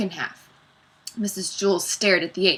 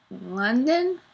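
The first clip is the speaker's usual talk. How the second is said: tentative, higher-pitched answer; nervous; 4 semitones higher than usual. A narrator's reading. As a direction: answer nervously, unsure of yourself. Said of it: "Leslie said nervously".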